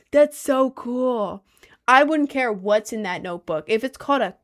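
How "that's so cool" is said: slow high gush. Real, not acted. Streamer swooning to chat over a grimoire. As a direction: draw it out high and loud.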